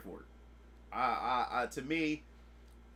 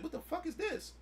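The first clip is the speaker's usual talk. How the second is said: baffled; 12 semitones higher, 4 dB quieter than usual. Retold or imitated. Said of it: "I was like".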